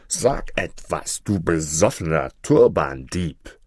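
put-on slow, drunken anger; full of rage. A narrator's reading. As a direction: drag the words out in tipsy fury. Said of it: "rief er voller Wut".

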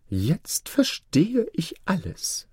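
high, slow, knowing vizier; scheming vizier. Acted. Vizier grasps the secret of the ring, scheming. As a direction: draw it out high as realization dawns.

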